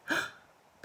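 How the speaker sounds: sharp unvoiced gasp. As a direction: gasp in shock. Shock at parents' demand.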